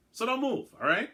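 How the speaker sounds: sharp, high, loud order; stern warning from the voice; firm command. Acted. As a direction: bark the order at him. Voice commanding Crichton.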